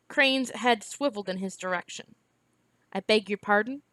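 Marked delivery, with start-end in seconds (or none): none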